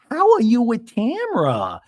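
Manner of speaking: high, slow mock voice; drawn-out, high-pitched imitation of a housewife; Gina impression. Host replays housewife probing about a castmate, high slow parody. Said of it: "a scene between Gina and Tamra going".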